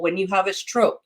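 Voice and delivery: ordinary delivery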